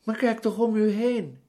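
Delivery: high-pitched indignant protest. A narrator's reading. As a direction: exclaim it, affronted at being doubted. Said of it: "vroeg Charles verontwaardigd".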